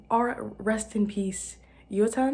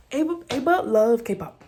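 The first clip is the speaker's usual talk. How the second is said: fangirl squeal at the name; excited gush; 5 semitones higher, 10 dB louder than usual. Real, not acted. Fan gushing to viewers, loud and excited. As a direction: gush about a favourite band, louder. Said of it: "It activates my stan girl gene".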